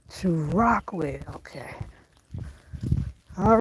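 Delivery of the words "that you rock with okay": whispered repeat, unvoiced; hushed echo of the phrase. Shown as a demonstration. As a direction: breathe the line as a whisper. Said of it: "repeats phrase in whisper".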